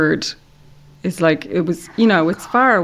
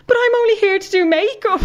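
high, helpless little voice; panicked whine; 13 semitones higher than usual. Retold or imitated. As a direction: squeak it out, out of her depth. Absurd panicked thought, then laughs.

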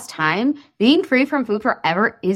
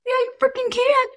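emphatic cheer; 13 semitones higher than usual. Real, not acted. Emphatic encouragement.

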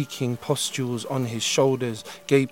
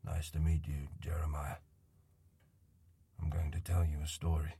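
put-on low, soft voice of a gentle old man; a weary old man coaxing a stranger softly; quiet old man; 8 semitones lower, 11 dB quieter, faster than usual. Old man character speaks.

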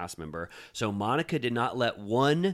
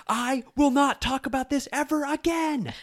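high-pitched, louder mock screech; imitated scream; 5 dB louder than usual. Cast member retold mid-fight, imitated screaming. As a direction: screech it out in a hammed-up outburst. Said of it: "she was screaming".

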